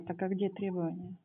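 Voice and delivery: barely audible, trailing off; too quiet. Real, not acted. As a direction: ask it almost under your breath. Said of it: "Я не слышу вас".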